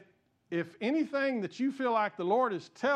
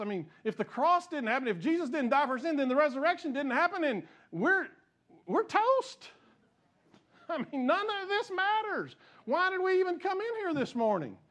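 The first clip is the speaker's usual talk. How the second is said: high-pitched, urgent rhetorical questions; impassioned preaching; 7 semitones higher than usual. Real, not acted. Preacher challenging the congregation over a doubted crucifixion, impassioned. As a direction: climb high with incredulous urgency.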